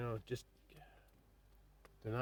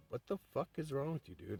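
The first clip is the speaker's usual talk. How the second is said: higher-pitched, indignant retort; mildly heated, pitched-up challenge; 6 semitones higher than usual. Retold or imitated. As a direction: retell an exasperated confrontation. Confronting brother who mocked him.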